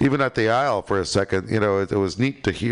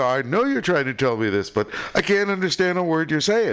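higher-pitched, pleading comeback; retold frustrated protest; exasperated reply; 7 semitones higher than usual. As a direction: act out helplessly not understanding someone. Acted exasperated reply in retold quarrel.

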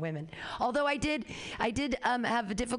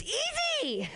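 high drawn-out mock ease.

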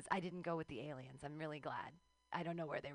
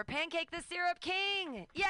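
louder, higher build to the name; hype announcer intro; 10 semitones higher, 7 dB louder than usual. Real, not acted. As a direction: pump the crowd up for the next act. Host introducing next comedian.

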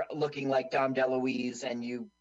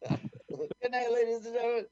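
grand showman farewell, higher; announcer voice; 8 semitones higher than usual. Acted. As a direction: sign off like a stage host. Mock showbiz sign-off.

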